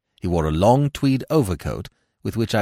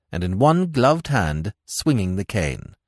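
usual speaking voice

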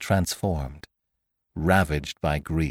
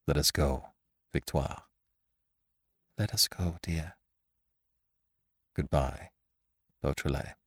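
very soft, low, spent voice; subdued farewell; 5 semitones lower, 9 dB quieter than usual. A narrator's reading. Lupin leaving with dead Raymond, hushed farewell. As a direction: speak low and softly, drained by grief. Bereaved Lupin leaves with the body.

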